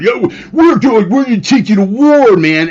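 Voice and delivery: high, loud mocking bravado; mock tough talk. Mocking rioters taunting police, high hammed-up bravado. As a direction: ham up a punk's boast. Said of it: "the minute the police give it".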